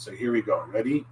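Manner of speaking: ordinary delivery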